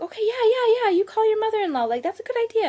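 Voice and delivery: high, hurried imitation of a friend; eager relief. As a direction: imitate a friend jumping at the idea. Friend eagerly approves the plan.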